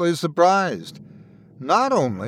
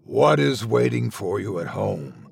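a lower creature voice, lightly growled; deep voice; 5 semitones lower than usual. Acted. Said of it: "the deep voice asked".

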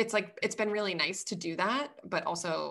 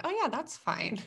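high, light, unbothered tone; breezy self-mimicry; 7 semitones higher than usual. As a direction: wave it off in a raised pitch. Mimicking own clueless approval.

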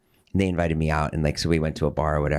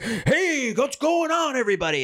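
much higher, booming mock Farley greeting; Farley entrance. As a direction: burst in like the loudest guy in the room.

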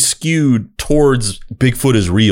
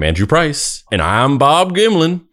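podcast intro voice; 6 semitones higher than usual. Retold or imitated. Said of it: "we'd be hearing".

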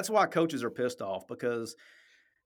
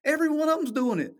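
much higher, louder, almost squealing the point; exclaiming everyone cheats; emphatic hell yeah; 5 dB louder than usual. Real, not acted. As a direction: burst out in disbelief that anyone denies it. Emphatic agreement about cheating.

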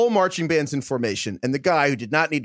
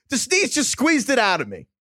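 distressed; 9 semitones higher than usual. Retold or imitated. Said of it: "And Will's like".

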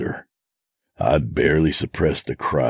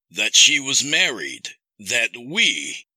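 high, measured villain voice; accusing captor; 8 semitones higher than usual. Acted. Captor reveals Sadie's marriage, accusing. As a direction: lay out the betrayal as the captor. Kidnapper's voice in narrated story.

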